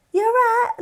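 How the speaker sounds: high, loud Cockney greeting from mum; mum's Cockney. Retold or imitated. Son imitating his mum greeting the producer, high loud Cockney. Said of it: "it's proper Cockney".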